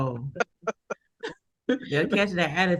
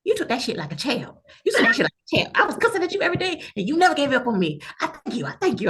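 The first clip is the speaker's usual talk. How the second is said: higher, rushing heartfelt thanks; a cussing caller finally grateful; 6 semitones higher than usual. Retold or imitated. Caller apologizing to the agent, higher and rushed.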